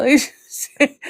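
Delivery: ordinary talk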